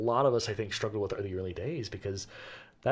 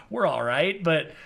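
usual speaking voice

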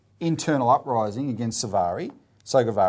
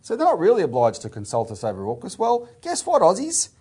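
high-pitched sarcastic retelling, louder on the punchline; mock sarcasm; 6 semitones higher, 3 dB louder than usual. Commentator mimicking the prime minister's jibe at Aussies, sarcastic. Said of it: "I love his sarcasm".

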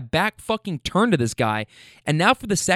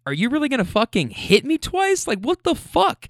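higher-pitched, indignant challenge; retold outraged disbelief; incredulous comeback; 9 semitones higher than usual. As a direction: throw it back at him in disbelief.

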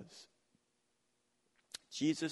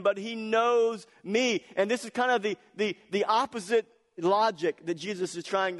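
louder, higher, pressing each point; fervent preaching; 7 semitones higher, 6 dB louder than usual. Acted. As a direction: preach it with rising conviction. Preaching at full force.

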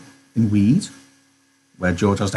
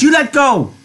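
very high, insistent cry. Acted. Curly's wife struggling to free her hair, crying out. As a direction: demand it at the top of your range. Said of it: "she cried".

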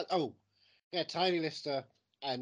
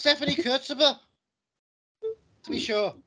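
a much louder and higher hammed-up Irish accent; 12 semitones higher, 10 dB louder than usual. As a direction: belt it out like a stage Irishman. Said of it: "an Italian name with an Irish accent".

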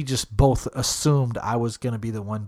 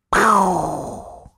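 loud, higher-pitched mouthed gunshot blast; imitated gunshot; 7 semitones higher, 5 dB louder than usual. Telling a hunting story, imitated rifle shot. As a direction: make a long, trailing gunshot noise.

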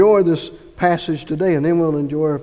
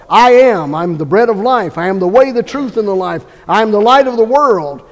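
louder, emphatic declarations as Jesus; voicing Jesus; 2 semitones higher, 7 dB louder than usual. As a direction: proclaim each I am boldly. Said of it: "And Jesus called himself".

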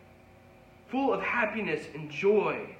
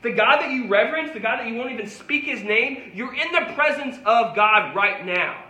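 louder, higher preaching build; preaching at full force; 4 semitones higher, 5 dB louder than usual. Acted. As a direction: drive it home to the congregation. Preaching climax.

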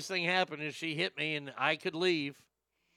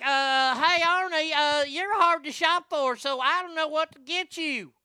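high, loud mocking wife voice; hammed-up ex-wife voice; ex-wife impression; 11 semitones higher, 8 dB louder than usual. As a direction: pitch way up and whine it like the ex-wife. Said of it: "she's like".

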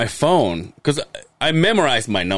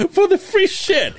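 mock high voice; slower than usual. [name] mocking.

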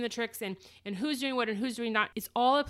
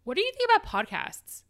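pitched way up, keen and bright; eager question; 12 semitones higher, 3 dB louder than usual. Retold or imitated. Scott pitching the podcast idea to her, eager and high. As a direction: ask it like a thrilled husband. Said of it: "He's like".

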